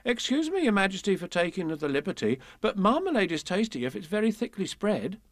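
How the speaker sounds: a touch flustered and deferential; bashful dairymaid. A narrator's reading. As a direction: apologise politely to royalty. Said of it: "she turned a little red".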